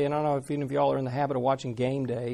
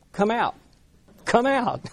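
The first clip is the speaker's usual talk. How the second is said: mock casual command; 6 semitones higher than usual. Careless disciples attempt exorcism.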